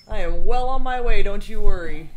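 loud sarcastic. Real, not acted. Joking about losing the race.

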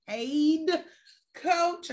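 PAID and COACH each set apart, high and loud; emphasis on PAID COACH. Real, not acted. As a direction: punch "paid", pause, then punch "coach". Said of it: "I gotta emphasize that".

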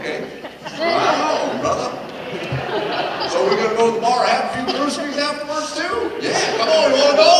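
loud, high, mocking enthusiasm; fake-hearty invitation to go drink; sarcastic mock invite. Pastor mocking the cussing helper with fake cheer. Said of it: "And I'm like".

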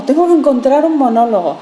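loud mock-stressed outburst; stressed actor. Coach mimicking actors hunting for a monologue, stressed. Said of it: "todo el estrés que hay".

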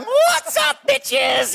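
a wild puppet wolf yelling; high puppet voice. Acted. Puppet greets audience.

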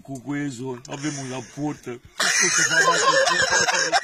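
wolf imitating mother goat badly, ending in a squeal; wolf's deep fake. Wolf at the door faking the goat mother's song, low then squealing.

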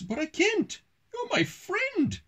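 very high-pitched storybook wail; hammed-up, high protest for a children's story; anguished character cry. A narrator's reading. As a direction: exclaim it way up high, in mock anguish. Two characters cry out in dismay.

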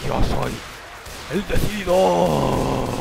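very slow, loud booming announcement; grand proclamation. Acted. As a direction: proclaim it like a hero's title. Proclamación solemne. (anuncio solemne, muy lento y fuerte)

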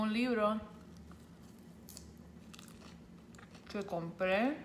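chewing while talking; speaking around a bite of food; mouth full. Real, not acted. Seen 4: Bites greens at 0.8, then talks with full, chewing mouth.

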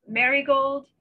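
ordinary delivery

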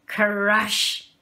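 dramatic crash; loud crash. A narrator's reading. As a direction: boom the crash out like a falling hut. Moose knocks the hut down.

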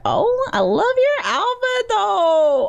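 high, excited squeal of admiration; gushing. Real, not acted. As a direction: gush over an outfit in a high voice. Gushes over a character's outfit.

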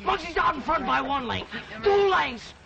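excited, high-pitched play-by-play; race commentary. Acted. Boy calling an imaginary horse race for the gang, excited commentary. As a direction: call the race to the crowd at full tilt.

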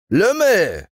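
high, loud insult yelled across; shouting. A narrator's reading. Shepherd yells up at the tree-tall giant, loud shout. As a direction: hurl the word up at a huge giant. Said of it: "schrie ihm der Hirt zu".

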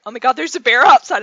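fast, loud startled blurt; alarmed exclamation. Retold or imitated. Husband bursting in to tell his wife about the bear, loud and fast. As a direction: rush it out loud like you just saw a bear. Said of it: "He's like".

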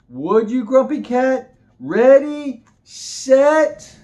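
high, loud race countdown; excited. Acted. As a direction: build up to the starting shout.

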